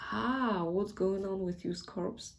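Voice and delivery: ordinary talk, nothing marked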